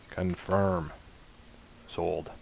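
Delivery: understated, muted confirmation; quiet confirmation. Real, not acted. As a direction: say it softly and drop it away. Confirming a sale of data.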